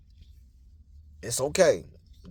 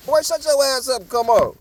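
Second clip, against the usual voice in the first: scolding grandma imitated; 5 semitones higher than usual. Grandma to kid begging for candy, imitated scolding. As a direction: chide like grandma with a child. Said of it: "That's how she said that".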